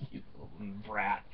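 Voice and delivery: muttered aside. Real, not acted. Muttered aside about daughter.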